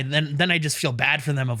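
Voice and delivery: normal talking voice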